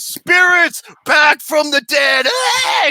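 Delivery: slow mock scream rising to a shriek; cartoon screaming. Retelling the cult member's scream, a hammed-up cartoon shriek. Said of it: "start screaming like a cartoon".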